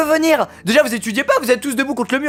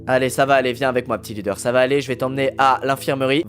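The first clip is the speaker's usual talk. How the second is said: much lower, soft mock-reassuring voice; mock soothing; 11 semitones lower, 3 dB quieter than usual. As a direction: pretend to calm the victim, deep and gentle. Fake comforting the victim. (voix grave faussement rassurante)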